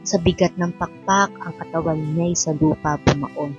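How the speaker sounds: ordinary talk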